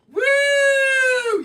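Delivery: high cheer; a sudden high whoop of joy. Real, not acted. Teacher cheers after the class chant.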